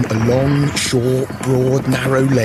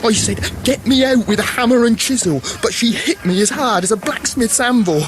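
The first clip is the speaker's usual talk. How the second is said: rising excitement; 11 semitones higher than usual. Acted. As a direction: pitch climbing higher toward a cheer. Mummer's nonsense rhyme climbing higher.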